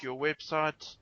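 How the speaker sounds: ordinary delivery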